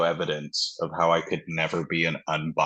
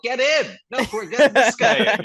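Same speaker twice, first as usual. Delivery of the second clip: much higher and louder, laughing through the parody; laughing mock voice; 7 dB louder than usual. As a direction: ham up the greedy buyers while cracking up. Mocking Boston on a burning car.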